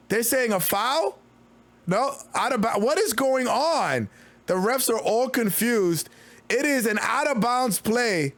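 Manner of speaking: quick, lively game call; play-by-play call. Acted. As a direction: call the action as it happens. Live play-by-play calling.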